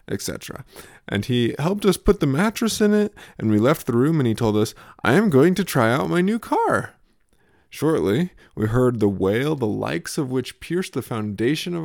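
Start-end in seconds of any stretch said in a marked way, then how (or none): 5.0-6.9 put-on toddler voice. Toddler announces trying his bed.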